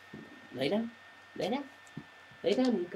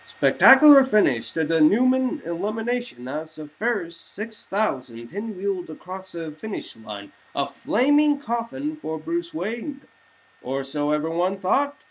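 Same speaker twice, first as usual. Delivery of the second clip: higher, louder bright newscast; news anchor read; 5 semitones higher, 3 dB louder than usual. Acted. TV anchors reporting on Bruce's crash, newscaster style. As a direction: deliver it like an evening news anchor.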